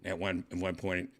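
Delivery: ordinary talk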